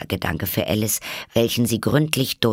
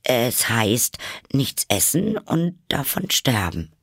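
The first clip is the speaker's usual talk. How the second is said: higher, tentative Alice voice; unsure; 6 semitones higher than usual. A narrator's reading. Alice explains starving to the Gryphon, tentative. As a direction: feel your way through the answer. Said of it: "sagte Alice unsicher".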